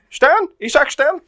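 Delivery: very high, loud calling question; the two men spotting each other, high startled calls. Retold or imitated. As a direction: call out in comic bewilderment. Both men calling out confused.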